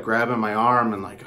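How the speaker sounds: normal talking voice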